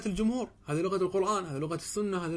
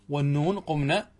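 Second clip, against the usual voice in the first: ordinary talk, nothing marked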